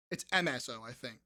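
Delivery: usual speaking voice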